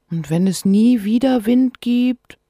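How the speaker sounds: put-on worried child. Child Sophia asking.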